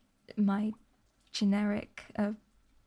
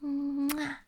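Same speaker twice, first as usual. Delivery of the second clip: exaggerated kissing smack; blown kiss; 3 semitones higher than usual. Streamer sends chat another kiss, a playful smooch. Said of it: "there's another kiss for everybody".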